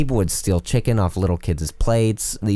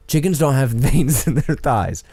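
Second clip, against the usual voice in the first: slow, higher smug voice; mock know-it-all customer; 6 semitones higher, slower than usual. Customer lecturing Corey, slow smug mock voice. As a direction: imitate a condescending customer. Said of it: "And he's like".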